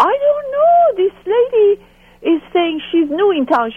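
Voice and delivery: playful high impression of the husband; high voiced husband. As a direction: squeak out the husband's confused reply. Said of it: "And the husband said".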